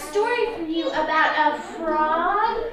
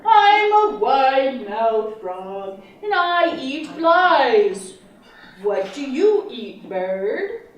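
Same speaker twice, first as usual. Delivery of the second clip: low, loud, slow frog voice; wide-mouthed frog voice; 3 semitones lower, 4 dB louder, slower than usual. Acted. Teacher voicing the frog for the class, low and booming. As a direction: boom it out like a proud frog. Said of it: "replied the bird".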